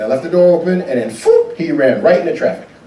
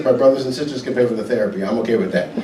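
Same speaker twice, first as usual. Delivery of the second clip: usual speaking voice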